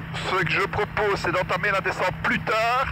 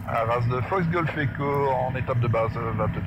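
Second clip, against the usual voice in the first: radio call; 7 semitones lower than usual. Acted.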